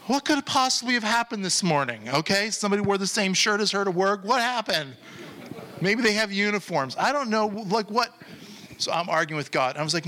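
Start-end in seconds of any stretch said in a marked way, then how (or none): 0.1-8.1 high-pitched, exasperated disbelief; incredulous protest. Retold or imitated. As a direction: argue back, pitch jumping way up. Arguing with God in his retelling.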